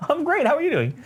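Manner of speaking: high, giggly awkward chit-chat; mock small talk. The stormtrooper makes nervous small talk, voiced high and giggly.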